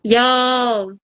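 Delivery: lightly voiced Jamaican robber, urgent; Jamaican accent. Robber urging his partner in a Jamaican accent. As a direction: Jamaican bank robber pushing for the loot. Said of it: "understand you Jamaicans".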